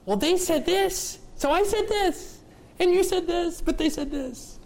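high, whiny tattletale voice; mock a squabbling tit-for-tat; whiny bickering voice. Mocking bickering spouses quote.

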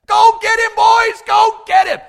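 high, loud yells urging someone to act; incredulous shout. Acted. David to the soldiers facing Goliath, incredulous shouting. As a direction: yell at them in disbelief. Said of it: "David is incredulous".

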